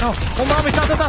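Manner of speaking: panicked yelling. Real, not acted. Panicked while being killed.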